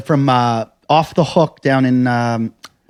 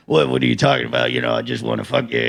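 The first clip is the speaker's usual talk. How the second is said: quick, lower, softer; mock clueless lech; 5 semitones lower, 6 dB quieter, faster than usual. Imitating Craig's clueless reply, fast and low. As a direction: rattle it off cluelessly. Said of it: "And he's like".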